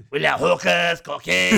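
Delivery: high, loud put-on Welsh accent; Pontypridd-Merthyr accent. Fan selling the trip to married mates, in a high, loud Pontypridd-Merthyr accent. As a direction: crow it in high-pitched Pontypridd. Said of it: "I'll do Miss Pontypridd, uh, slash Merthyr".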